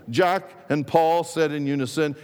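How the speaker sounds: usual speaking voice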